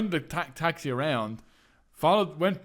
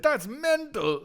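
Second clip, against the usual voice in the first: high, very slow drawn out; lightly voiced amused driver; 11 semitones higher, much slower than usual. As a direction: stretch it out amused. Driver reacts to story.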